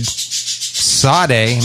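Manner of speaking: "[name]" said as SAH-day; alternative pronunciation. Shown as a demonstration. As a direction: say [name] with a plain s, two syllables.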